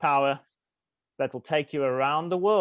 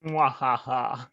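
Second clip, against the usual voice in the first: evil laugh; 2 semitones lower, slower than usual. Acted. Villain introduces scheme.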